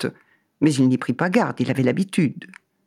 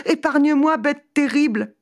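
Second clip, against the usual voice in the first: high-pitched, frightened plea; terrified pleading; 11 semitones higher than usual. Acted. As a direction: beg the beast for mercy, high and scared. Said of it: "Il fut tant effrayé".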